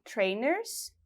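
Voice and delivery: "trainers" said with an English pronunciation, not Portuguese sounds; teacher reads out "trainers" as an English vocabulary word. Shown as a demonstration. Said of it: "no inglês britânico".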